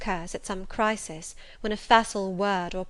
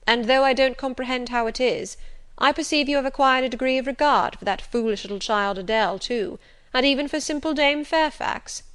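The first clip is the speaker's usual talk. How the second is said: louder, slightly lifted gentleman's speech; a hint of a commanding master; lightly voiced Rochester; 4 semitones higher, 6 dB louder than usual.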